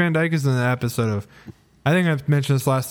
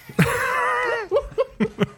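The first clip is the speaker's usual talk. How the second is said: high laugh in character. Pretend. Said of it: "just one more thing".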